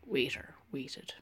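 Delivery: soft unvoiced aside; whispered wordplay. A narrator's reading. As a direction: breathe the words out. Whispered narration.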